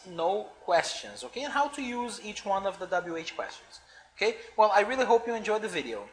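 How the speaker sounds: ordinary talk, nothing marked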